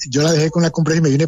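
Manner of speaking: ordinary talk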